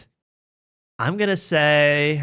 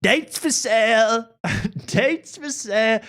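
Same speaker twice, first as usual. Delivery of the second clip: vendor call; 10 semitones higher, slower than usual. Retold or imitated. Mock street vendor call.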